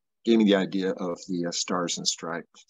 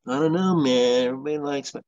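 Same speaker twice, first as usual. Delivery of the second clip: higher, louder imitated drag racer; Snake impression; 6 semitones higher, 6 dB louder than usual. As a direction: mimic the racer shrugging it off. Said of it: "I went".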